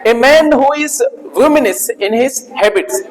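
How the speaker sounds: very slow, word by word; slow dictation of a definition; dictation pace. Shown as a demonstration. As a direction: read it out slowly for students to copy. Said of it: "बोलो".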